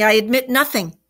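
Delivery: storybook character digging in; firm. A narrator's reading. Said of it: "he said quite firmly".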